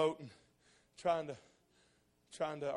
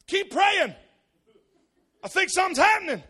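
urgent excitement; 15 dB louder than usual. Retold or imitated. Danny excited during miracle.

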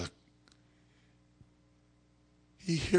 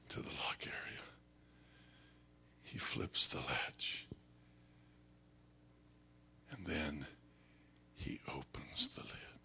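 very soft, low, near-whispered narration; hushed suspense; 4 semitones lower, 12 dB quieter than usual. A narrator's reading. Preacher narrating the opening of the lid, hushed suspense. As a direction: spin it out softly with long pauses.

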